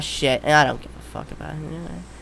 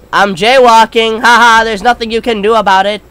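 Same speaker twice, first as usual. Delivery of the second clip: taunting; 6 semitones higher, 11 dB louder than usual. Real, not acted. Taunting the game monster.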